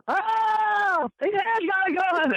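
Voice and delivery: high shrieking panicked woman; mock hysterical woman screaming; hollering woman. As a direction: shriek it like a terrified lady. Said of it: "All of a sudden".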